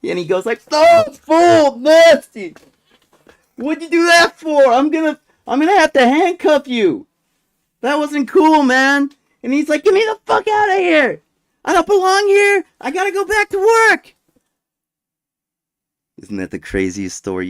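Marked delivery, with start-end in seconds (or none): 0.7-9.1 loud, high mock outrage; hammed-up guard protesting loudly; disgusted guard. Retelling guard grossed out, scolding. 9.8-14.2 very high, loud imitated shouting; hammed-up irate detainee yelling; extremely irate. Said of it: "this guy was extremely irate".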